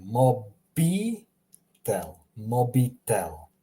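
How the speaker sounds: "mobitel" slowly as mo-bee-tel; Croatian pronunciation. Shown as a demonstration. Teacher drills for learners, slow "mobitel" with ee. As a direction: stretch each syllable of "mobitel". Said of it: "like I have here".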